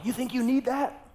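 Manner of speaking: high, mock-angry yell of a stranger; retold yell. Stranger at the gas pump yelling at the Hummer driver, high mock yell. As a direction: bark it at the driver across the pump. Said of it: "they yelled at the top of their lungs".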